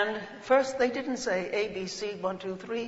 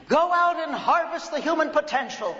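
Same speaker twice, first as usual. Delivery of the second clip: higher, louder grand command; imitating Margaret Mee; 8 semitones higher, 4 dB louder than usual. Adopted mother sending her out, imitated high and grand. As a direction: charge her with a mission in the mentor's voice. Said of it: "I'm imitating exactly what she sounded like".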